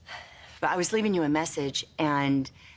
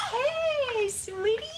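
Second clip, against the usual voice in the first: put-on baby talk; much slower than usual. Mother cooing at baby.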